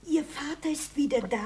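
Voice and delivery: hushed whisper; warning whisper. Acted. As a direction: tip him off under her breath. Housekeeper warns in secret.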